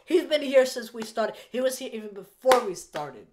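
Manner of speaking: high, loud protest; exasperated insistence. Real, not acted. Host defends guest's presence to the chat, exasperated insistence. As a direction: raise your voice and insist.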